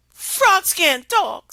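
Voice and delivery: high, loud and slow princess; high loud princess, slowed right down. Acted. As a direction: scoff as the haughty princess. Princess scoffs at frog.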